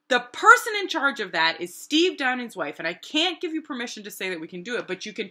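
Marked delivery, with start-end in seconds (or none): none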